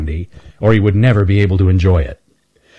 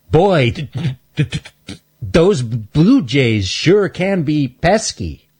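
high voice catching on the f, stammering; Stanley stammers out his excuse; stuttering squirrel; 7 semitones higher, slower than usual. Acted. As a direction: get stuck on the f before it comes out. Stanley stammers, changes subject.

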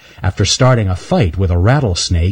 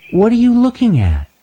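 put-on high, sweet little girl squirrel; little girl squirrel calling from behind a bench. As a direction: ask it like a curious little girl. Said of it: "asked a sweet little voice".

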